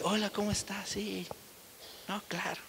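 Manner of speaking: lightly voiced gentle giant, barely there; soft, timid answers of a once-tough man; meek soft reply. Imitating the calmest, meekest man.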